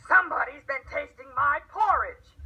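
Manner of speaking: loud growling papa bear; booming big bear voice. A narrator's reading. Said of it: "he growled out".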